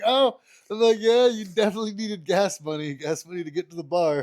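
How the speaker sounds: loud, high mock agreement, dripping sarcasm; sarcastic. Brother who spent gas money at the bar, loud high sarcasm. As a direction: needle him with fake belief. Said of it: "so I'm like".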